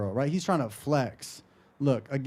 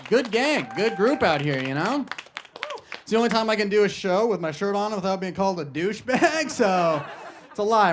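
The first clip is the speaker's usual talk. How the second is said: normal talking voice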